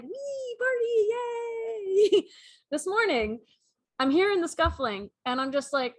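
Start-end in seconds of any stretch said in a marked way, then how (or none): 0.0-2.3 high squeaky cheering voice; giddy little mice celebrating warmth; partying mice. Pretend. Mice cheering that the heat is on, high and squeaky. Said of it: "they're all like".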